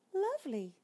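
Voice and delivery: very high, dainty mouse voice; tiny mouse asking for tea; polite little mouse. Acted. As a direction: squeak it out politely. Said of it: "said the mouse".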